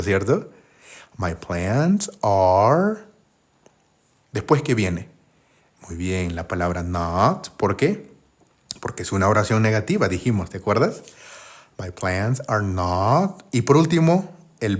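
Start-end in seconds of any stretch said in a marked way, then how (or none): 1.2-3.0 drawn out, word by word; very slow model phrase. Shown as a demonstration. Teacher modelling English for Spanish learners, very slowly. 11.8-13.4 drawn out, word by word; very slow model phrase. Shown as a demonstration. Teacher modelling English for Spanish learners, very slowly.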